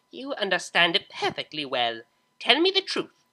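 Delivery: stern mother snapping; stern demand. Acted. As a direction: demand the truth, losing patience.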